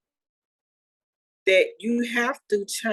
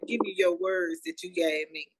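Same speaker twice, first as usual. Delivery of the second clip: ordinary delivery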